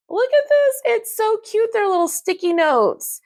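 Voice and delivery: high-pitched gushing over something cute; excited squeal. Real, not acted. Gushing over cute sticky notes.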